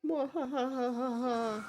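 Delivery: slow, drawn-out evil cackle; psycho laugh. Pretend. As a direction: cackle like a gloating villain. Villain cackle during cutscene.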